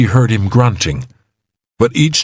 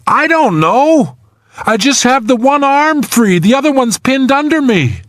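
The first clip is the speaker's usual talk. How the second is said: high, strained trapped-man voice; trapped man, anxious. A narrator's reading. Trapped man tells rescuer one arm is free, anxious. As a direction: plead anxiously, pitched very high.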